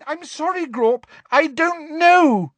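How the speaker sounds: very high pleading, rising to loud; terrified squeal. A narrator's reading. Girl apologising to the roaring giant, terrified squeal. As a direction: plead in a panicked squeal, slowing at the end. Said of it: "squealed Hermione, terrified".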